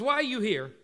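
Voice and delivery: mildly irritated sibling question. Acted. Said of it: "you get irritated with one another".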